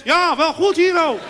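high, loud answering holler; neighbour shouting back. Acted. Neighbour in the flats answering father, shouted reply. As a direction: yell the reply from another flat.

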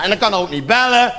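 normal talking voice

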